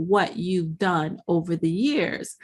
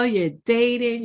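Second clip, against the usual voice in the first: usual speaking voice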